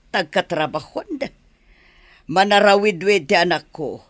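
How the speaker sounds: ordinary delivery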